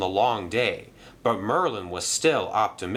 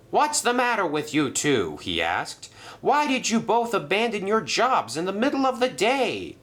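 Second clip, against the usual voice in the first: scolding manager; 11 semitones higher than usual. Acted. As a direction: tell him off about the fish. Percival scolds both.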